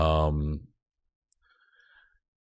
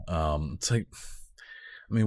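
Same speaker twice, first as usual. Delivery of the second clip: normal talking voice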